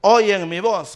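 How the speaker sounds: loud, slow, emphatic proclamation; Jesús. Retold or imitated. Preacher quoting scripture to the congregation, loud slow proclamation. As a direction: proclaim the quote with weight, drawn out. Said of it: "Jesús dijo".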